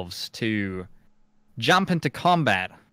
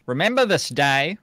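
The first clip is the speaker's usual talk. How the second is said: grand proclamation; 3 semitones higher than usual. Acted. Rescuer announces himself to freed salamanders, grand proclamation. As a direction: declaim it grandly, pausing between phrases.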